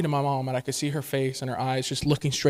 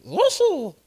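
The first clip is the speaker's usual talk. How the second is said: high cartoon cry from the back of the voice; throaty high creature call; Yoshi; 12 semitones higher, 4 dB louder than usual. Shown as a demonstration. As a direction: squeal the name from back in the throat. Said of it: "does it in the back of his voice".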